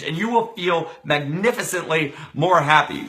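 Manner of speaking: fast, loud, high hype-speaker promise; rally the viewers with a fast, loud promise; pumped motivational pitch. Acted. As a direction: sell the promise at full energy. High-energy motivational opening.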